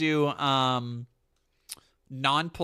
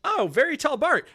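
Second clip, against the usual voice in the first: excited greeting; 11 semitones higher, 6 dB louder than usual. Retold or imitated. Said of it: "Eden was like".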